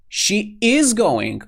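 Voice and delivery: IS punched high and loud; stress on IS. Shown as a demonstration. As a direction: contradict slowly, hitting IS hard. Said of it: "highlight the auxiliary with your voice".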